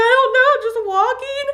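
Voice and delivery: very high mock-sobbing whine; crying. Daughter on phone wandering randomly, high tearful wail. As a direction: wail it helplessly, half laughing. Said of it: "I immediately start crying".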